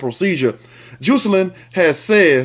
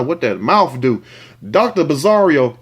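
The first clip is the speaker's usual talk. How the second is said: ordinary talk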